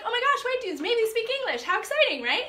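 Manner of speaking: high, bright-eyed rush of excitement; excited gasp. Retold or imitated. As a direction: gush like you just found fellow English speakers. Excited thought about English speakers.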